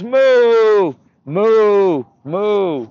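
long, high, loud moos, easing off; loud cow mooing. Acted. Boy offering animal sounds to God, cow mooing. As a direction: moo out like a cow. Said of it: "on the top of his lungs, beautiful, uh, impersonation of a cow".

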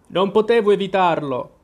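annoyed. Acted. Cinque answers Due's scolding, mildly irritated. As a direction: brush off the blame, mildly cross. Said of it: "con tono seccato".

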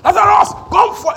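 high, very loud command; full-force screamed summons. Acted. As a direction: scream it out to wake the dead. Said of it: "He screamed".

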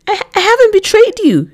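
frightened woman pleading with her accuser; desperate protest. A narrator's reading. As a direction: beg him to believe you.

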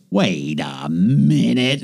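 very slow, drawn-out words; dawning realization. Retold or imitated. Man retells nearly falling for scam email, slowly realizing. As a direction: stretch each word out as it dawns on you.

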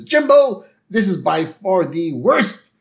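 high, loud call, then slow scolding; upset Skinner. Retold or imitated. Principal upset with student over spilled stew, mock scolding. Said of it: "Skinner's kind of upset right now".

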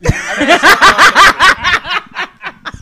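loud, slow, laughing through it; cracking up while repeating it. Real, not acted.

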